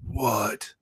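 soft, voiceless gasp of a word; hushed, shocked reaction. Retold or imitated. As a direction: imitate stunned disbelief, barely any voice. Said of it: "in shock".

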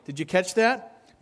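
ordinary delivery

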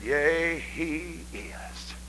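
soft and slow, trailing to a whisper; hushed awe. Retold or imitated. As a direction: hold it in hushed reverence. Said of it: "She said".

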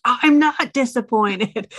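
imitating upset kid. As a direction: protest like a crying kid denying it. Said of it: "They're like".

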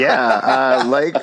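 breaking up with laughter; laughing. Real, not acted. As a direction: laugh through the words. Laughing fit.

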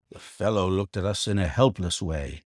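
usual speaking voice

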